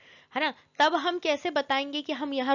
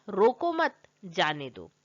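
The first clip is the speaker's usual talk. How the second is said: रोको मत together, break, then जाने दो much lower; pause after मत; 5 semitones lower, slower than usual. Shown as a demonstration. Teacher offers another wording, pausing after मत. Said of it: "तो अब हम क्या कहेंगे?".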